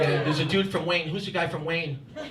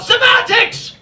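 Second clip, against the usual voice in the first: put-on very high, loud English-accented shriek; hammed-up English-accented mother's outburst; angry English accent; 11 dB louder, much slower than usual. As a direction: dismiss it with a mock English scream. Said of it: "she does it all in an English accent".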